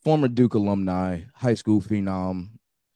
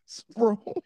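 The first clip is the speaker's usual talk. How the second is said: high-pitched, laughing protest, getting louder; laughing disbelief; 4 dB louder than usual. Real, not acted. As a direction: squeal it out through laughter, can't believe it. Incredulous reaction to phone bill.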